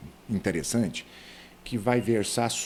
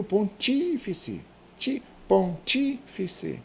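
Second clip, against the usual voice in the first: "pontífice" high, with a long TÍ; stressed TÍ; 8 semitones higher, much slower than usual. Shown as a demonstration. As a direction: isolate "ti-", then stretch it in "pontífice". ("pontífice" com o TÍ agudo e alongado)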